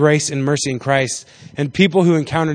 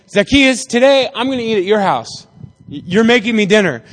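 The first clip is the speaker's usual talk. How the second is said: mildly raised, higher pitched call; retold call up into a tree; calling up to someone; 4 semitones higher, 3 dB louder than usual. Jesus to Zacchaeus up in the tree, calling out.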